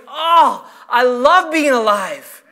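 drawn-out, delighted declaration; heartfelt cry of joy; joyful exclamation. Acted. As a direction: savor it slowly, glad to be alive. Voices a joyful waking exclamation.

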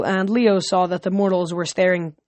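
ordinary delivery, nothing marked

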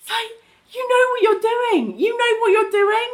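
accusing. Retold or imitated. Scolding Billy's selfishness.

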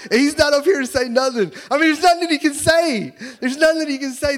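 crowing with delight; exultant. Real, not acted. Pastor gloating happily before the crowd about Jeff.

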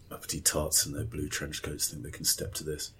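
very soft, low mutter; hushed, disdainful grumble to oneself; muttered aside. Acted. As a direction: grumble it under your breath. Snide aside after elevator exchange.

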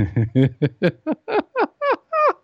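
high, rising giggle fit; uncontrollable stoned giggle. Retold or imitated. First-time smoker insisting nothing works, mock giggle fit. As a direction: imitate helpless giggling that keeps climbing. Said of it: "I don't know why I'm laughing".

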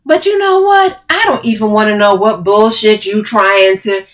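loud, heated brush-off starting high; angry dismissal. Acted. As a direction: cut them off angrily mid-quarrel. Heated quarrel, Camille cuts off.

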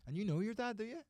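straight-faced needling question, rising at the end; deadpan wind-up. Shown as a demonstration. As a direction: wind them up without hinting it's a joke. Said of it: "no sense of it really being a joke".

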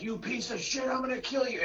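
high-pitched comic rant; mock angry threat. Movie fan imitating a character on the chase, mock angry.

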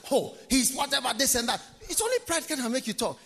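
ordinary talk, nothing marked

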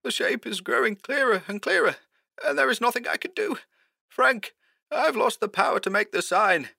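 very high, soft, strained voice; frightened high voice, losing hope; hoarse, despairing. A narrator's reading. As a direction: confess helplessly in a thin, high pitch. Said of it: "more hoarsely this time".

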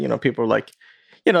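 normal talking voice

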